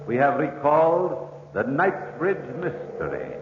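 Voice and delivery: slow, grand closing announcement; radio announcer sign-off. Acted. Announcer closing the mystery show, slow and solemn. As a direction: draw out the last call, solemn and grand.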